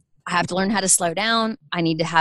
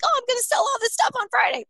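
naive excitement; faster than usual. Retold or imitated. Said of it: "I'm like".

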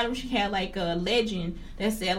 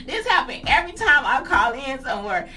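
laughing through it; 3 semitones higher, 7 dB louder than usual. Real, not acted. Laughing at own mistake.

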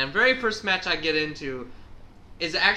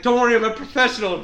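mock reassuring killer; 8 semitones higher, 9 dB louder, faster than usual. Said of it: "he's like".

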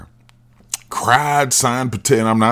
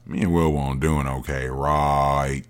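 low, soft, coy voice; lower mock Jada; 8 semitones lower, 6 dB quieter, slower than usual. Comic voicing Jada confessing to Will, low and coy. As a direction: say it low, fishing for sympathy. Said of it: "She's like".

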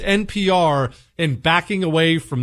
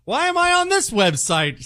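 higher, puzzled mock voice of a lost donor; confused donor; 9 semitones higher than usual. Donor sent to the wrong website, mock confused and higher. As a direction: play the baffled customer who landed on the wrong site. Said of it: "they're all of a sudden".

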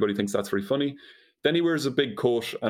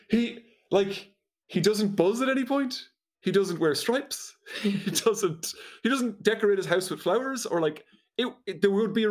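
high-pitched, talking through laughter; laughing incredulously; 9 semitones higher than usual. Real, not acted. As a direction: protest the absurdity while trying not to laugh.

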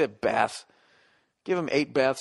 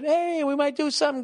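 much higher, sing-song fake hope; mock teasing team; 13 semitones higher than usual. Sharks teasing their fans, high mock sing-song. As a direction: tease the fans like a cocky team. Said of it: "They get 'em all excited".

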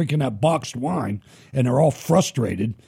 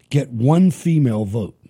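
usual speaking voice

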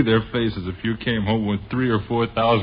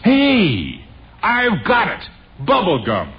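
high, loud eureka cry, dropping on the punchline; excited brainwave; 6 semitones higher, 4 dB louder, slower than usual. Acted. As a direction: burst out with a sudden idea. Sudden idea while brainstorming.

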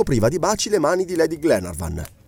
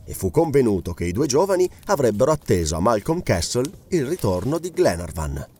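usual speaking voice

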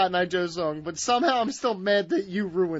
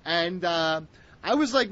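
usual speaking voice

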